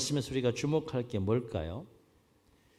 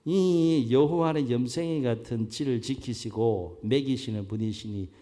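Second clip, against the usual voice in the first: loud Chungcheong dialect recitation; 충청도 사투리; 3 semitones higher, 9 dB louder than usual. Acted. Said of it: "충청도 버전이 있더라고요". (큰 소리로 늘어지는 충청도 사투리)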